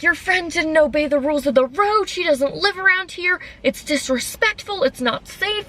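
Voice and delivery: high-pitched mock whine; whiny mimicry. Imitating the lady going on and on, whiny. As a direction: nag on in a high, complaining imitation. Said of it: "in, like, this super whiny voice".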